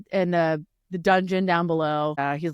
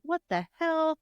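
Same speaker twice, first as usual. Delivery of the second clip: retold startled reaction; 10 semitones higher than usual. Retells character's startled reaction.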